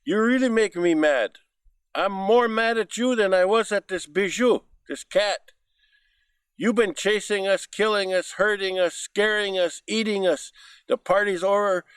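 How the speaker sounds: angry rabbit. Acted. Rabbit angry at laughing fox.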